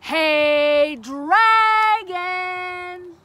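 huge, drawn-out yell; very loud, high, long holler; shout as loud as possible. A narrator's reading. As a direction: bellow it to wake a sleeper. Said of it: "shouted as loud as she could".

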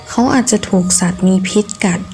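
plain reading voice

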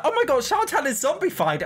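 high, loud gasp of discovery; astonished outcry. Real, not acted. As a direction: exclaim it, voice leaping up. Discovers character became a zombie.

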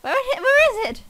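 high, loud frustrated demand. Real, not acted. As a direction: demand it impatiently. Frustrated searching.

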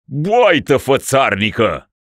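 loud, slow, spat-out insult; scornful insult. Acted. Sick ruler insulting the metropolitan. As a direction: spit it at the priest. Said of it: "adăugă bolnavul, zbuciumându-se".

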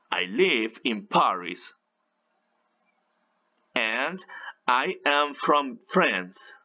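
slow, careful English for learners to repeat; phrase by phrase, with long pauses; slow practice model. Shown as a demonstration. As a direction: model each phrase slowly and clearly for repetition. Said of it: "van a decir esto también".

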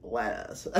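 put-on American "lettuce", said softly; North American accent. Friend repeating a fast food order, mock American accent. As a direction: imitate an American saying "lettuce". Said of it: "My boy had to go".

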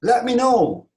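loud, high-pitched call; shouting from afar. Shown as a demonstration. Teacher models calling from a distance, shouted. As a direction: project it to someone far away. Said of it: "Uzaktan bağırıyorsunuz".

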